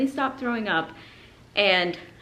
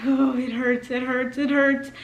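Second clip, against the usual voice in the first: higher pained cry, retold; 5 semitones higher than usual. Mother pushing through labour, mildly pained. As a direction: wince it out.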